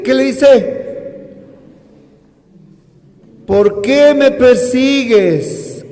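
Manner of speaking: loud, high, slow preaching; full-force preaching. Acted. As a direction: thunder it from the pulpit.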